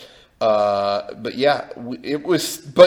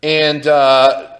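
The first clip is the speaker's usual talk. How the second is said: ordinary talk, nothing marked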